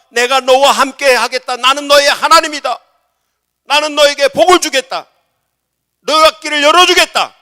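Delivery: very loud, high divine declaration; thunderous voice of God in a sermon; God's booming promise. Acted. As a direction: proclaim each promise at full voice. God's promise to Jacob, acted.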